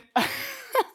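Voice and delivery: high giggling burst; can't hold back the laugh; laughing at her own story; real burst of laughter. Real, not acted. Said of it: "I can't even say this without laughing".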